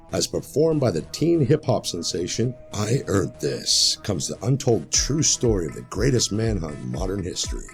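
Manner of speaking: ordinary talk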